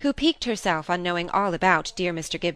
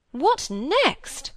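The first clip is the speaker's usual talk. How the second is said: high-pitched disbelieving exclamation; scandalised amazement; 8 semitones higher than usual. Acted. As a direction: exclaim at the outrageous news. Sister astonished by the news.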